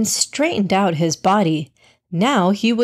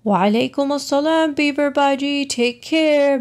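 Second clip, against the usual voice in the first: calling out; 7 semitones higher than usual. A narrator's reading. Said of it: "he called out from behind".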